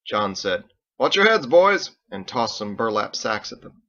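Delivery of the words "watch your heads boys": put-on loud, high call to the boys. John calls out to the boys, loud and high. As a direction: shout a warning across the clearing.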